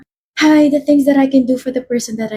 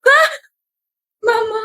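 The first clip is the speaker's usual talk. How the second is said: ordinary talk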